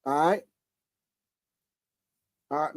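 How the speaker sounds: ordinary talk, nothing marked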